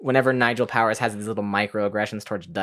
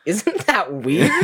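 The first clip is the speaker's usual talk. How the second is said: slow giggling Goldmember; 4 semitones higher, much slower than usual. Retold or imitated. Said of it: "He's like".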